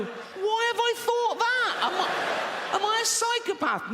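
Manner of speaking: very high, hammed-up panicked wail; panicked self-questioning. Man horrified by his own intrusive thought, mock panicked wail. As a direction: wail it in mock horror, far above normal pitch.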